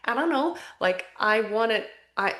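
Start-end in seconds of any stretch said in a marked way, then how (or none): 0.1-0.6 high, unsure mock fretting; imitating her anxious past self; fearful avoidant. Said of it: "oblivious little fearful avoidant going".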